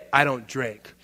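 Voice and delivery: low, curt brush-off; deflated refusal. Acted. Passed-over colleague turns down the celebration, deflated. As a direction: drop right down from the excitement, unimpressed. Deflated reply to the excited coworker.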